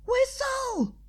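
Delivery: very high mock scream. A narrator's reading. Pinky shaking Twilight: high mock shriek. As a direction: shriek the order. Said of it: "Pinky screamed".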